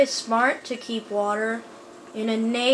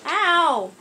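startled ow; 6 semitones higher, 6 dB louder than usual. Real, not acted. Getting shot in the game.

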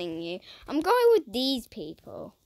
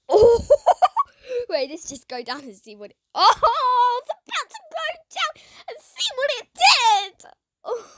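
laughing; 11 semitones higher, 8 dB louder than usual. Real, not acted.

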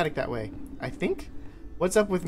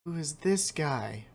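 ordinary delivery

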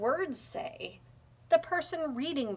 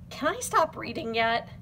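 ordinary delivery, nothing marked

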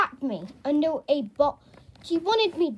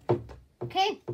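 ordinary delivery

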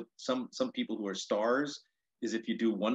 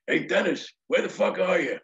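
higher-pitched shouted impression; George Carlin; 8 semitones higher, 5 dB louder than usual. Carlin calls down to his opener backstage, mock holler. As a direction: imitate someone hollering from upstairs. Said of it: "I hear George's voice going".

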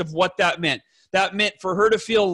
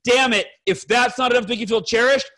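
normal talking voice

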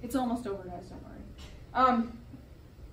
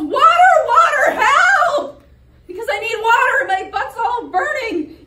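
loud, high screaming for water; desperate yell; 11 semitones higher, 15 dB louder than usual. Acted. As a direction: scream like your backside is on fire. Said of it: "So he yells".

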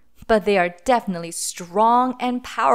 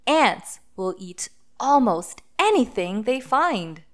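ordinary delivery

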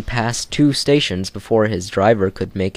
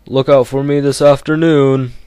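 a proud engine calling back, higher and louder; Gordon the proud engine; 5 semitones higher, 5 dB louder than usual. Acted. Gordon calls to Edward while leaving, proud engine voice. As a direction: boast as you puff away. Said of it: "said Gordon".

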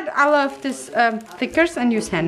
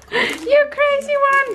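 high-pitched laughing words; laughing delight. Real, not acted. Vlogger to generous friend, laughing in delight. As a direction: laugh through it, affectionately teasing.

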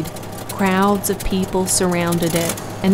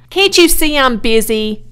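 loud, higher-pitched exasperation; put-upon child's irritated retort; disgusted; 5 semitones higher, 8 dB louder than usual. A narrator's reading. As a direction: snap back at a nagging adult. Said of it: "replied Avery in disgust".

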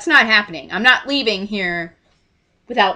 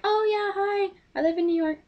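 high-pitched, soft little imitation voice; friend chiming in; 11 semitones higher, 6 dB quieter than usual. Friend mentions she lives in New York, high mock voice. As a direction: pipe up in a small high voice.

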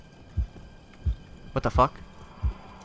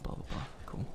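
whispering; 19 dB quieter than usual. Real, not acted. Quiet gaming whisper.

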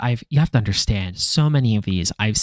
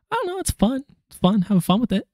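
high, squeaky little voice; high put-on voice; light dismissive voice; 12 semitones higher than usual. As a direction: pitch it way up, airy and carefree. Voices the other hand lightly.

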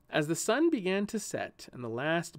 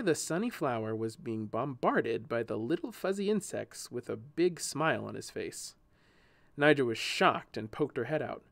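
ordinary delivery

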